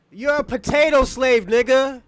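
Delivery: loud taunting yell; shouted insult. Real, not acted. Streamer yelling an insult at his debate opponent. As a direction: yell it right in his face.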